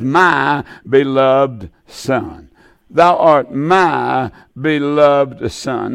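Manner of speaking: slow, weighty reading of God's words; slow solemn scripture reading. Acted. Preacher reading God's words to his Son slowly and solemnly. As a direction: let each word of the verse land slowly.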